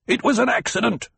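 fast high roaring denial. A narrator's reading. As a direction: roar the denial at him. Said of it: "Jameis roared".

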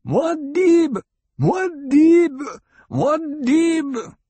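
high, long-held rallying shout; battle cry. A narrator's reading. A fighter spots the leader in the crowd, echoing battle cry. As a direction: raise a loud, ringing war cry. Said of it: "his battle cry was lifted to echo in the chamber".

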